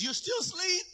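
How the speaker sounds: high, thin frantic voice; panicking servant. Acted. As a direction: pile up the alarms in acted panic. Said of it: "He's, he's panicking".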